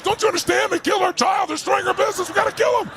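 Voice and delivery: high-pitched retold tirade; furious friend. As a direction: imitate a worked-up partner, pitch soaring. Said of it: "my furious friend over here".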